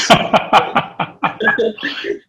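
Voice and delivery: real chuckling laugh, mostly breath; amused laugh. Real, not acted. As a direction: break into a rippling laugh. Amused by his own Tharoor joke.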